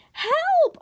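very high, loud cry for help; screamed. A narrator's reading. Girl dangling from the plane, screamed. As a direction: scream it out in one burst. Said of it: "I screamed".

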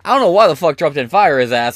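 usual speaking voice

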